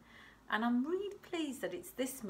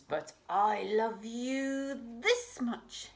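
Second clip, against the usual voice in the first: slow and louder, grand; big hare; 6 semitones higher, 7 dB louder, slower than usual. Acted. Big hare outdoes little hare, slow and grand. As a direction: lean into it like a big patient parent.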